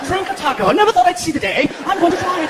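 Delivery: high-pitched put-on British voice; mock British accent. British viewer amazed by drinkable tacos, mock British accent. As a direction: marvel in a hammed-up British accent.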